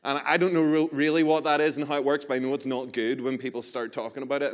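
ordinary talk

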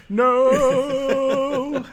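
long, high, loud hammed-up wail; mock despair. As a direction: stretch it into a melodramatic cry. Acted cry of dismay in retelling.

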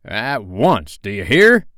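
loud captain's order, rising at the end; imperious shouted order; cried command. A narrator's reading. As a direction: demand obedience as a pirate captain. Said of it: "cried Peter".

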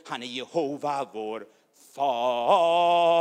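loud fervent preaching, tongues mixed in; preaching at full force. Real, not acted. Preacher crying out to the church, loud and fervent. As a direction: proclaim it like fire over the congregation.

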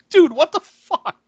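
high, loud, drawn-out disbelief; incredulous outburst. Retold or imitated. Reacting to a movie character's blunder, incredulous outburst. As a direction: blurt it out high, stretching the words.

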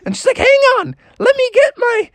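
very high, bubbly mother; a mom thrilled by a dance class; squeaky high excited mom. Acted. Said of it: "Froggy's mom woke up and was like".

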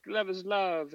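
high mocking imitation of a protester; mocking quote. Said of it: "whenever they're".

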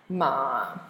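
low, dipping "ma"; third tone. Shown as a demonstration. Teacher running through four tones on one sound, "ma" low and dipping. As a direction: sink "ma" right down low. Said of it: "Now, M A".

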